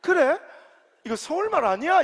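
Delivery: lightly voiced daughter asking innocently; a hint of a small Busan girl, honestly puzzled; innocent child in dialect. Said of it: "서울말인지 경상도 말인지도 모르는".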